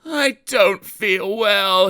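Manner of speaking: slow, high put-on moaning; pretending to be poorly; faked groan. A narrator's reading. Sir Handel faking illness in the morning, slow groan. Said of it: "he groaned".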